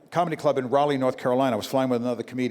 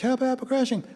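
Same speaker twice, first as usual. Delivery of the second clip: mock cry for help; 9 semitones higher than usual. Mock passengers crying out.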